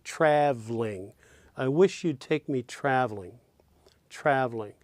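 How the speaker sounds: traveling said as trav-ling; clipped traveling. Shown as a demonstration. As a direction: drop the middle syllable of traveling. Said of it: "omitting the second syllable".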